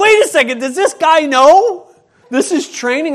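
hammed-up alarm that this is real; loud retold panic that ends in a laugh; alarmed disbelief. Realizing guard means it.

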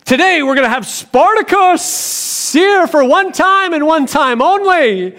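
high, hyped-up promoter shout; mock event announcer. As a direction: parody a big show announcement. Imagined early church event announcement.

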